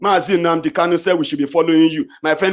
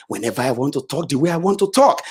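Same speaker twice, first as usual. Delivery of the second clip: normal talking voice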